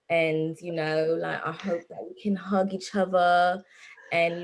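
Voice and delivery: hammed-up British accent for the UK fans. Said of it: "You convinced me".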